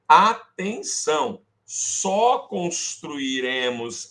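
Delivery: slow stressed rule, lean on futuro; emphatic slow rule. Shown as a demonstration. Teacher drilling the rule to students, slow and stressed.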